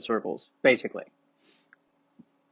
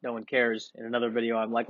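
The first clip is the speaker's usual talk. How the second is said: ordinary talk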